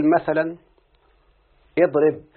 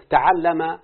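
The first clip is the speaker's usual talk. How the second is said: slow demo; much slower than usual. Shown as a demonstration. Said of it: "teacher demonstrates the verb form".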